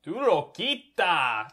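slow, hammed-up fake Spanish accent; mock Spanish. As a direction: drag the words out in a joke Spanish accent. Mock Spanish after "No habla español?.

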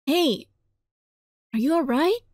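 usual speaking voice

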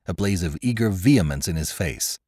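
ordinary delivery, nothing marked

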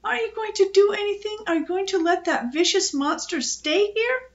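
put-on high-pitched whiny child's plea; wailing protest. A narrator's reading. Scared girl demanding her mother act, high wail. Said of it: "Phoebe wailed".

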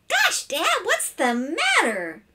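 high-pitched, wide-eyed question; innocent boy. Acted. Boy questioning his angry father, innocently high. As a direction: ask in a high, puzzled child's voice.

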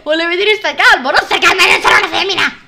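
loud, indignant protest; loud mock outrage; indignant outburst. Real, not acted. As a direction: snap back at full volume. Mock outrage at being told calm.

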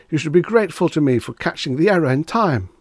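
high, loud, indignant urgency; frantic protest. A narrator's reading. Reggie pressing Mister Demise to believe him, high and urgent.

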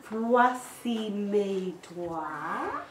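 teacher modelling a finger rhyme for toddlers; very slow sing-song. Shown as a demonstration. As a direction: drag out Voici mes doigts with a big lift at the end. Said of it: "let's see your hands".

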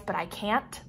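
ordinary delivery

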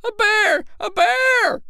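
high, loud alarmed shouting; crowd's cry. Acted. Townsfolk taking up the alarm cry, loud and high. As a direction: spread the panic. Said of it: "took up the cry".

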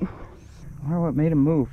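normal talking voice

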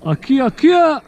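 high, loud urgent call; excited shout. Real, not acted. Angler calls out as the fish pulls line, excited.

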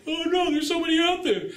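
high whiny laughing mock wail; mock crybaby. Coach mocking timid seller as crybaby, high whiny. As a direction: ham up a crying baby. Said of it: "Stop being a crying little baby".

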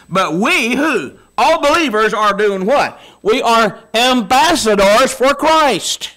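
rising preacher's call, drawn out; full-force preaching. Acted. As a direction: drive each word home like a revival preacher. Preacher building to a climax.

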